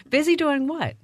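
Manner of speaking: laughing, high-pitched question; amused challenge. Real, not acted. Host teasing guest who claims to be too busy, laughing. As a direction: tease through a laugh.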